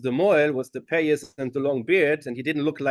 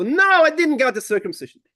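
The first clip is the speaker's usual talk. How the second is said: much higher and louder insistence; indignant protest; 11 semitones higher, 7 dB louder than usual. Retold or imitated. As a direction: insist he was never cut. Leonid protesting to the moels.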